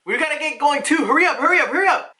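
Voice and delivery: high, pressed rallying call; urging to hurry. Acted. Pirate rushing the crew to set sail, urgent.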